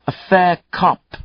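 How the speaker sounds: high, slow, hammy fake British accent; bad British accent. Killer mocking the cop in a bad British accent. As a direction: ham up a Monty Python British accent. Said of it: "he lapsed into a bad British accent".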